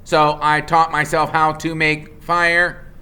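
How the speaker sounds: a calm robot stating a fact. Acted. The robot Roz states it plainly, a level machine voice.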